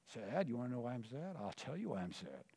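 a low, quiet, sulky comeback; sulky sad reply. Acted. Answering God's gentle question with a low, quiet sulk.

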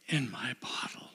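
hushed whisper of God's words. Acted. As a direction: whisper it tenderly. Quoting God, voice drops.

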